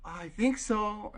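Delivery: high, slow, hesitant reply; acted uncertain woman's voice; imitated unsure woman.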